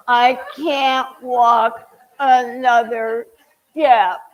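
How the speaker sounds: slow, loud, stretched-out whine; drawn-out exhausted wail. Retold or imitated. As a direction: moan it like you're about to collapse.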